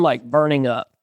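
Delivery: ordinary delivery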